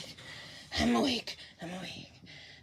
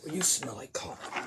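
soft unvoiced whisper; 8 semitones lower, 11 dB quieter than usual. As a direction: breathe it as a secret. Quiet remark.

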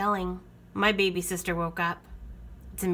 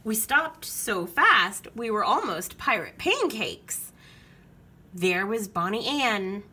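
ordinary delivery

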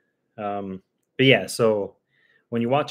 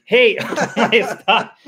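loud, higher hey, then stop; sharp yell; 10 semitones higher, 8 dB louder than usual. Real, not acted. Owner yelling at her barking dogs, loud and sharp.

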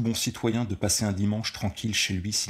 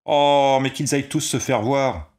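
very loud, higher dismissive yell; scornful shout; 7 semitones higher, 9 dB louder than usual. A narrator's reading. Ball player scoffing at the complaining tenants, scornful shout. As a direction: brush them all off loudly.

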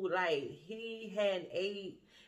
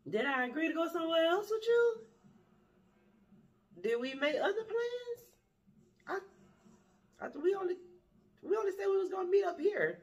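incredulous; 10 semitones higher, faster than usual. Retold or imitated. Incredulous questions.

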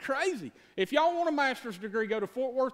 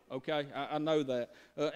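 usual speaking voice

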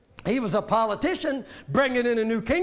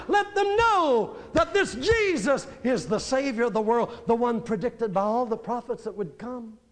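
a swelling sermon peak; preaching at full force; 4 semitones higher than usual. Acted. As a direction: lift the room, call it out.

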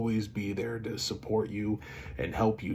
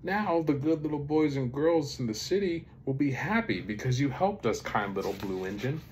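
high excited start, settling toward the end; grateful toys cheering the engine; happy cry; 6 semitones higher than usual. A narrator's reading. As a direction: celebrate in a lifted storybook voice. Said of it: "cried the funny little clown".

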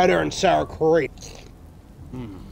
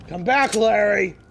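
raised, higher call; 5 semitones higher, 5 dB louder than usual. Pretend. Calling the bird back, loud and high. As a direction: call after someone who is leaving. Calls after departing bird.

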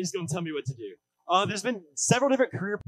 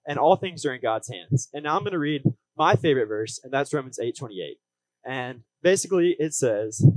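upbeat youth pastor; 5 dB louder than usual. Acted. Preaching with growing force.

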